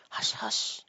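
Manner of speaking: urgent whispered shushing; low, hurried tone. A narrator's reading. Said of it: "in a low, hurried tone".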